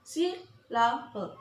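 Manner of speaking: "syllable" said slowly, part by part; broken into syllables. Shown as a demonstration. Teacher showing learners the three syllables, slowly split up. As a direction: draw out "syllable" one beat at a time. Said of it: "has three syllable".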